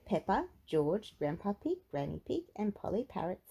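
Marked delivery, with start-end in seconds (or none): none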